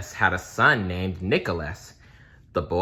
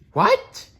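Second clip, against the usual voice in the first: much higher, loud shocked outburst; shocked what; 5 dB louder than usual. Real, not acted. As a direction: react in disbelief to the law. Narrator reacts to unfair law.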